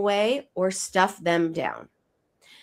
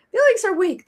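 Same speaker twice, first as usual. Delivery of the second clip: "Feelings are weak" much higher and louder, hammed up; exaggerated mock lecture; 12 semitones higher, 5 dB louder than usual. Host hams up society's message, higher and louder. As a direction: punch "Feelings are weak" harder on the repeat. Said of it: "our culture says two things".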